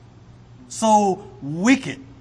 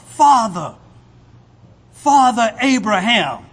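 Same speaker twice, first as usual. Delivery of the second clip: loud, higher pleading cry to Abraham; rich man crying out; 4 semitones higher, 8 dB louder than usual. Acted. Rich man in torment calling to Abraham, loud pleading cry. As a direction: call out desperately, louder and higher. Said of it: "He cried out".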